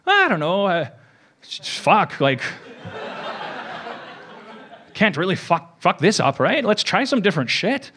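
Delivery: playful fake Irish accent; bad Irish accent. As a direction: ham up an Irish accent as a joke. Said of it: "that was a horrible accent".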